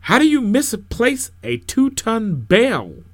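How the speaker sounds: loud, high, drawn-out challenge; slow, disbelieving yell; incredulous shouting. Acted. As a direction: demand an answer from across the church. Same objector challenges the minister.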